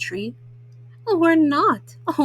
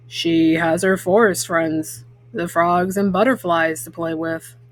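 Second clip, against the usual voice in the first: a big papa bear asking gently; deep papa bear; 8 semitones lower than usual. Acted. Papa asks mama at the window, low voiced.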